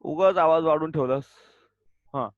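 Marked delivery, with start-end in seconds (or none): 0.0-1.3 loud, sharp call across the room; calling out names. Real, not acted. Teacher calling out chattering pupils, loud stern call. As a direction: snap the names out over the noise.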